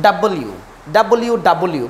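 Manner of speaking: ডাবল ইউ with an open "da-bol-you"; careful, repeated ডাবল ইউ; correct W. Shown as a demonstration. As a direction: sound every syllable of ডাবল ইউ. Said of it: "বলতে হবে".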